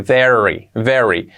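normal talking voice

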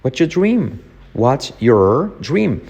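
ordinary delivery

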